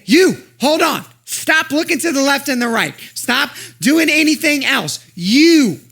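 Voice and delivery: high, loud call to stop and listen; pleading voice calling someone back; urgent calling. Acted. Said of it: "And he's saying".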